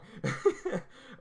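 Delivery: high unvoiced laugh. Real, not acted. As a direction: burst into a giggle at yourself. Laughs at own tangent.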